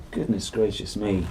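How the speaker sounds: ordinary delivery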